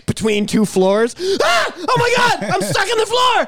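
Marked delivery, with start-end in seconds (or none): 1.4-3.5 high shouted panic, much higher; trapped and freaking out; hammed-up high panic; panicked scream. Stuck between floors.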